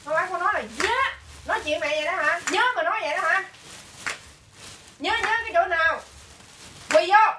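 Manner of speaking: building from firm to a loud, high furious shout; rising anger. Real, not acted. Mother scolding her child for talking back, building anger. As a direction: scold harder and harder until you explode.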